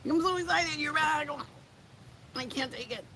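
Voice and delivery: high, squealing mock excitement; hammed-up squeal of excitement at his return; mock gushing fan. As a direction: gush like a fangirl. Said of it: "tell him how excited you are".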